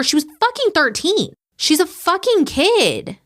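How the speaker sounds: high-pitched, incredulous indignation; real outrage. Real, not acted. Host appalled that the victim is a kid, outraged.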